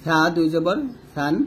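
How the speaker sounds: সা and সান as a thin "th", tongue at the upper teeth; light th sound in সা; thin lisped tha. Shown as a demonstration. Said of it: "পাতলা করে বলবেন".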